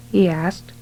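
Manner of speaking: ordinary delivery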